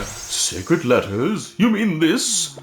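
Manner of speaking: a cranky ghost woken up. Acted. Ghost character reads dialogue.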